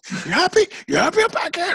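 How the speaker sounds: high, squeaky mocking voice; mock character voice. Host imitating the movie character in the garbage truck, high and squeaky. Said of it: "why they make my man get in a fucking garbage truck".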